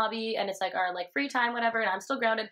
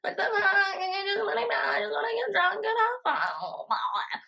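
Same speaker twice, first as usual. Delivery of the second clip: put-on much higher, slow whining teenage wail; whiny teen self; 10 semitones higher, much slower than usual. Grounded teen complaining to herself, much higher whiny wail. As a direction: whine like a sulky sixteen-year-old, near tears. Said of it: "my sixteen-year-old self".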